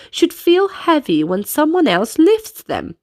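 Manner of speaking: plain reading voice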